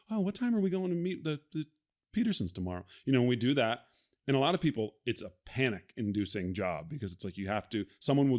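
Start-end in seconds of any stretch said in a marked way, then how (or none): 0.1-2.8 much higher, chirpy background small talk; mock chatty extra. Actor demoing loop group chatter, a bubbly extra asking a question. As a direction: play a bubbly restaurant extra making plans. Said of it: "be like".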